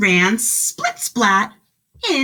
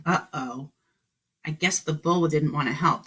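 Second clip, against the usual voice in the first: usual speaking voice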